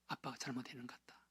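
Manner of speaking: whispered apology, fast; a father's whispered plea; hushed whisper. Retold or imitated. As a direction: breathe the confession out softly. Father begging son on knees.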